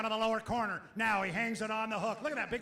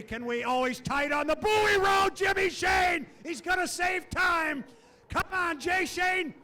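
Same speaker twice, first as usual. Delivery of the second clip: loud, high race call; shouting the lap home; excited play-by-play; 4 semitones higher, 4 dB louder than usual. Acted. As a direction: call the run as it happens. Live race call of qualifying.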